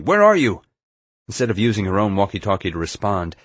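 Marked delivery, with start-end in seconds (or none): 0.0-0.6 high, loud, urgent yell. A narrator's reading. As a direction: yell into a walkie-talkie to find someone. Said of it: "I yelled into my walkie-talkie".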